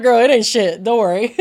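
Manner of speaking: waving it off to a friend; sassy reassurance. Retold or imitated. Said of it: "I was like".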